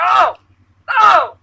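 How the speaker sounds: high, loud excited yells; reenacted yelling. Fan retelling his Super Bowl yells, loud excited shout. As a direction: relive yelling at the TV during the play. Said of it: "I yelled so many different things".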